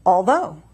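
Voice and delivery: "although" drawn out slowly, weight landing on "though"; iambic stress. Shown as a demonstration. Poet gives the host another example, "although" stressed on the end. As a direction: stress on the second syllable of "although". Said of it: "second syllable is stressed".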